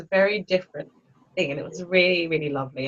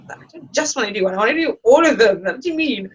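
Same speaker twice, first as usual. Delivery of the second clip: retold protest; 4 semitones higher, 5 dB louder than usual. Retells arguing with her teacher.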